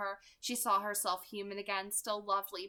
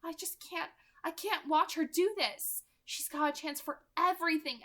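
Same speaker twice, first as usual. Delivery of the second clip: 1 pained Rosalie; 8 semitones higher, 3 dB louder than usual. Acted.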